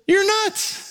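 high teasing jab; playful mocking jab. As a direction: toss it off in a high mock voice. Mocking the unbeliever.

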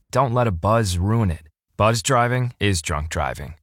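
ordinary talk

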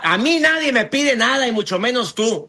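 high-pitched indignant mock retort; imitando al gritón indignado. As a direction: play a defiant yeller refusing orders. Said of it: "nada más un uno por ciento". (gritón desafiante, en tono agudo)

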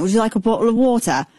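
quick imitated British accent, politely offered. Rocker offers the pregnant fan water, imitated British accent. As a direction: do a Birmingham English accent, fast and courteous. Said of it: "in his accent".

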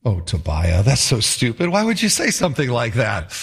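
low mock-exasperated reproach, rising gradually; mock groaning. Everyone reacting to Tobiah's joke, mildly exasperated. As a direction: lightly groan at a bad joke, starting low. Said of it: "I hear everyone going".

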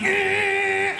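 long, high held "eh"; high driving noise. Acted. Comic cuts people off as a Quebec driver, long high noise. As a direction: careless whine held high. Mimics her careless driving.

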